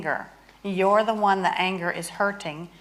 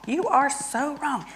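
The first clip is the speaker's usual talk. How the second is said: high exasperated jab; 6 semitones higher than usual. Shown as a demonstration. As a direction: pitch jumps up on "You are so wrong". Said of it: "so I'm angry at my husband".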